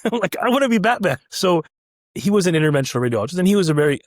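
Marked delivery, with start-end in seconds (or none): 0.4-1.2 high, eager declaration; starstruck excitement. Retold or imitated. Trainee after watching his new hero, excited. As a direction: gush it like a new fan.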